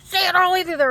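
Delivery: very high, very loud re-enacted yell; shouted ultimatum. Man threatening speaker who pleads, shouting. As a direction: bellow the threat at the top of the voice.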